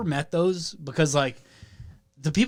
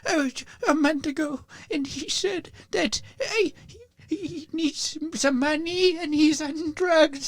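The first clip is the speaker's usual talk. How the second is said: put-on very old woman; 11 semitones higher than usual. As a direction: plead like a frail widow.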